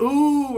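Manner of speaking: high, loud, long "ooh"; crowd's "ooh". Retold or imitated. Teller shows how the crowd reacts, high loud "ooh". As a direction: mimic a crowd's rising reaction. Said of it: "do the".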